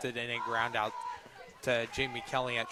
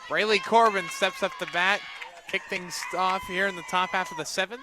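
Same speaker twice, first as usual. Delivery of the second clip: lively batter call; 5 semitones higher, 5 dB louder than usual. Real, not acted. Announcing the batter.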